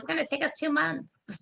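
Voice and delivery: mock high-pitched quote; Crystal. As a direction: quote a friend in a high, lighter put-on voice. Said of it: "'Cause Crystal was like".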